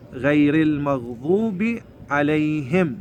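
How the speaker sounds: slow غير المغضوب عليهم, "ghayril", "him"; careful model of غير المغضوب عليهم; kasra endings. Shown as a demonstration. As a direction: recite it measured, "i" on غير and عليهم. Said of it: "هي غيري بالكسرة".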